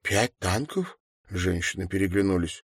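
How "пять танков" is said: puzzled question. A narrator's reading. Women react, puzzled.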